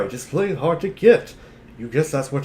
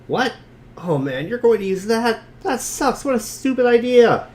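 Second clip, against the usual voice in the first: much higher and louder, openly mocking the idea; scornful outburst; 9 semitones higher, 5 dB louder than usual. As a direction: scoff at how stupid the plan is. Mocking the chosen item.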